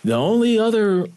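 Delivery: high, mocking coach voice, rushing at the end; teasing impression of the coach jabbing a player; imitating Coach Carrill. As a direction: needle him like the old coach did. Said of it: "Coach Carrill used to use that against me".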